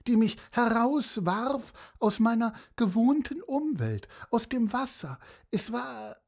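a hint of a chatty seahorse telling tall tales; storyteller slipping into the seahorse's rambling voice; lightly voiced seahorse. Seahorse rambles to Pieper about its adventure, lightly voiced.

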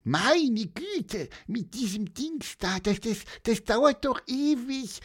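high bird voice with an impatient groan. Acted. Little bird groaning to policeman about the typewriter, high impatient voice. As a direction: moan at the slowness, chirpy and high. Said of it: "stöhnte Pieper".

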